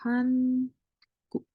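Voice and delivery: Hanguk drawn out, HAN held high, then a short guk; syllable by syllable. Shown as a demonstration. Teacher reading out the assembled word for learners, syllable by syllable: Han, guk. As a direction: sound out Hanguk as two separate syllables. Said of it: "Berarti ini adalah".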